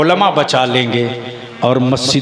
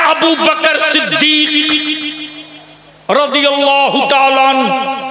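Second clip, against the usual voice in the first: rising preacher's litany; 14 semitones higher than usual. Acted. Preacher praising Abu Bakr to the crowd, rising chant. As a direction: build each title over the last. Preacher lists honorific titles.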